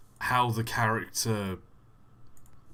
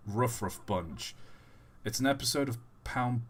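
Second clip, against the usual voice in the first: ordinary talk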